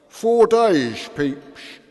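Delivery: put-on loud, high drunken heckle; drunken shout. Drunk on the floor yells at Pepys, loud drunken shout. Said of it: "shouted a drunk from the floor".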